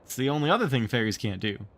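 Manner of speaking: ordinary delivery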